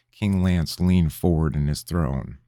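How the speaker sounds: ordinary delivery, nothing marked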